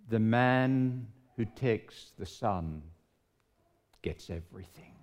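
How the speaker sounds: preacher's hushed climax; solemn reveal. Acted. Sermon's climactic line.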